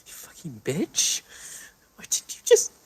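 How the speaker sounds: sobbing, self-hating, whispering; tearful anguished outburst; crying. Acted. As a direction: break down crying and curse yourself. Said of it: "she starts crying".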